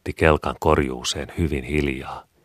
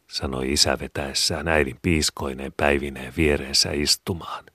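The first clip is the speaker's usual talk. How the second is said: usual speaking voice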